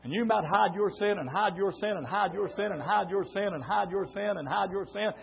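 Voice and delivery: ordinary talk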